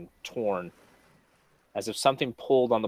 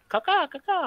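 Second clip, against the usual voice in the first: high cawing crow call; bird caw. Acted. GM imitates bird sending.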